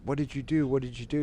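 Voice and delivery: retold frantic question; urgent questioning. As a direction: act out pressing him for answers. Friends urgently questioning him, retold.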